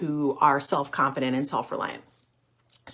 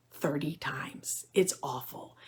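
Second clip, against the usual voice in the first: hushed whisper, much softer; 3 semitones lower, 12 dB quieter, slower than usual. Acted. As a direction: drop to a confiding whisper. Dramatic aside about going back.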